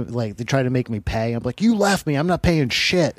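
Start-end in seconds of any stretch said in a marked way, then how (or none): 1.6-3.2 loud, higher, indignant; flatly refuse, raising your voice; angry refusal. Retold or imitated. To the organizers demanding payment: indignant shout.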